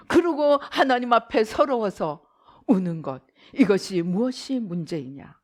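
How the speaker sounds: usual speaking voice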